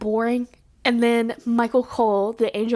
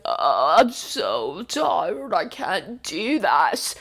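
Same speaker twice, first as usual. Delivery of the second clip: slow, weary mock groan; faking tiredness; 4 semitones lower, much slower than usual. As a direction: drag it out like you're exhausted. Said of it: "and he'd be like".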